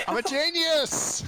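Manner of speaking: high, slow, hammed-up boast; mock triumphant. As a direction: crow it like a cartoon villain. Player mocks self-praise.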